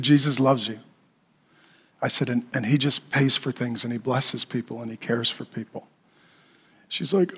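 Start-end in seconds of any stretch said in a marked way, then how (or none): 0.0-5.9 low, soft, tender explanation; gentle reassurance. Retold or imitated. Pastor consoles a tearful stranger, soft reassurance. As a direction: drop the voice and comfort softly.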